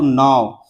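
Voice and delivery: नाव drawn out very slowly; नाव pulled long into its final V; stretched vowel. Shown as a demonstration. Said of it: "ज्यादा खींचना पड़ा".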